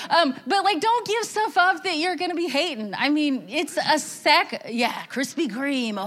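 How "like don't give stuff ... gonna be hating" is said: usual speaking voice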